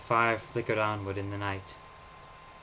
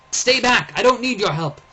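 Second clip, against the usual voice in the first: loud, high shout of refusal; 12 semitones higher, 11 dB louder than usual. Acted. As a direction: drive the boy away. Rebuffs boy as soldiers near.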